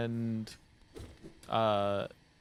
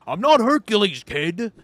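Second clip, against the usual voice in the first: much higher, louder repeat of the gripe; angrier old man shout; 9 semitones higher, 13 dB louder than usual. Retold or imitated. Old man shouting again at the cashier who ignored him. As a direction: yell it now, losing patience.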